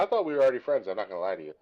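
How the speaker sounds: normal talking voice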